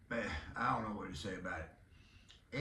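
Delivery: ordinary talk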